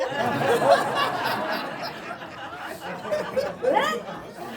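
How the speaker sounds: high, long burst of laughter; real laughter. Real, not acted. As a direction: crack up at the line. Laughs at his own read.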